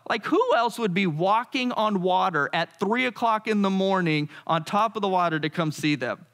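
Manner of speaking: ordinary delivery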